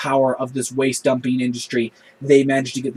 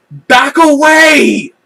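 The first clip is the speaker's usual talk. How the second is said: much higher, much louder, slow yell; 11 semitones higher, 11 dB louder, much slower than usual. Retold or imitated. B'Elanna yells a second warning at the worker. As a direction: drag out "Back away!" as a yell. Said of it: "she yells at him twice".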